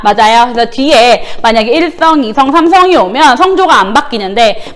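normal talking voice

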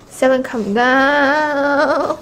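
loud, stretched-out plea; overexcited wail. Real, not acted. Fan telling Seven to calm down, loud wail. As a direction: wail the last word long and loud. Mock plea while fangirling.

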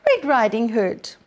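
ordinary delivery

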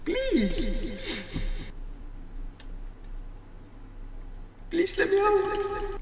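put-on high, whiny kid begging; pleading child voice. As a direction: beg to be let out like a small child. Voicing trapped kid character.

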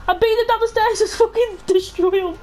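ordinary delivery

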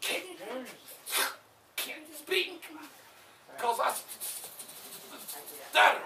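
stammered s- sounds before stutter. Acted. Preacher playing Moses stammering he can't speak. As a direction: catch on every s- before stutter lands. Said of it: "Moses stuttered".

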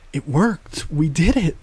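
put-on overjoyed young Jedi shouting out their success; excited. A narrator's reading. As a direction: burst out with relief at the win. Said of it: "she said excitedly".

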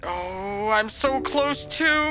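ordinary talk, nothing marked

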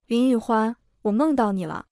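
usual speaking voice

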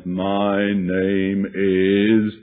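a man's growl coming out of a woman, dragged out; gruff demon voice. Retold or imitated. Demon speaking through the woman, gruff and drawn out. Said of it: "deep, harsh, gruff, masculine-sounding voice".

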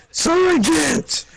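high, shouted mock soldier; mock recruit shout. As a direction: yell like a panicked private. Mocking drill sergeant exchange.

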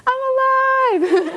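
high laughing shout of joy, drawn out slowly; joyful scream. Retold or imitated. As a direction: yell it from the rooftop, laughing. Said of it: "like, scream".

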